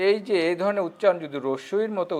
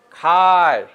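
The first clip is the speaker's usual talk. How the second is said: খায় louder, with a long held vowel; drawn-out word; 6 dB louder, much slower than usual. Shown as a demonstration. Teacher demonstrating the verb ending, খায় drawn out. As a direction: stretch খায় firmly through to its final য়. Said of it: "টানে উচ্চারণ করবে".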